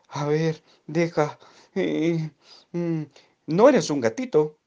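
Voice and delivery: hohe, lautere Kükenstimme, freudig steigend; zähl auf und jubel am Ende; Vögelchen. Acted.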